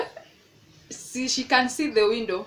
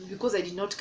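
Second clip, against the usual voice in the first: ordinary delivery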